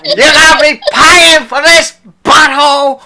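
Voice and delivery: high, slow, laughing mock shout. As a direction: shout it back at them mockingly. Mocking the quoted line loudly.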